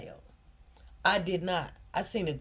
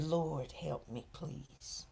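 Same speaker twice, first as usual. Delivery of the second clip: much softer, lower, barely voiced plea to God; whispered prayer; 8 semitones lower, 15 dB quieter, much slower than usual. Mother praying with head down in court, barely audible. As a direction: hush it to nothing, a private prayer.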